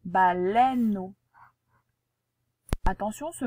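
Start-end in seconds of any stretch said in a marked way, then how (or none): 0.0-1.1 Baleineau said very slowly, open è; careful pronunciation. Shown as a demonstration. Teacher repeats the word very slowly for the pupil. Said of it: "avec le est de treize".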